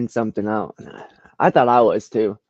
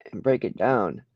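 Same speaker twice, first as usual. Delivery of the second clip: ordinary talk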